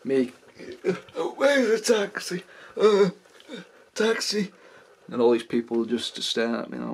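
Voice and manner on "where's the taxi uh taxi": put-on high, slow drunken calling; drunk mess. Drunk self before station crowd, high woozy call for a taxi. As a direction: imitate yourself drunkenly hunting for a cab. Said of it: "I'm such a mess when I'm drunk".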